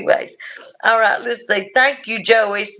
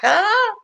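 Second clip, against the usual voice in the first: short scared cry, pitched way up; frightened ah; 13 semitones higher than usual. Retold or imitated. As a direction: yelp at the swollen snakebite. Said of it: "I was like".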